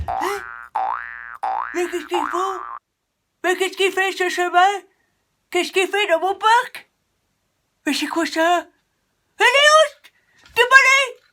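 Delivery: loud, high outraged shouting; a furious keeper yelling at a bear; angry park keeper. Acted. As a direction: chase the intruder out.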